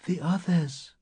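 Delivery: high, soft, faint voice; faint child-like whisper. A narrator's reading. Woman behind barricaded door, faint child-like whisper. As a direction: whisper like a child beneath the covers. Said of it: "A child whispering to a friend".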